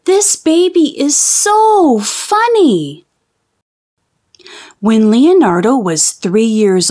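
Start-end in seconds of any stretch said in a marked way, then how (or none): 0.1-3.0 high, slow, delighted mother voice; doting mother. Acted. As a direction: coo it like a proud mom. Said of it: "They said".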